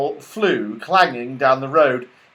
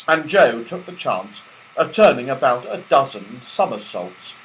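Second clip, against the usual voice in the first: usual speaking voice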